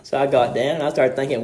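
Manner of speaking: normal talking voice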